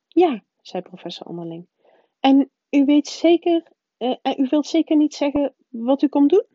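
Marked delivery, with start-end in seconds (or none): 0.1-0.4 higher, louder prim schoolmistress, insistent; the strict witch pressing her point; stern professor voice. A narrator's reading. McGonagall voiced by the narrator. 2.2-6.5 higher, louder prim schoolmistress, insistent; the strict witch pressing her point; stern professor voice. A narrator's reading. McGonagall voiced by the narrator.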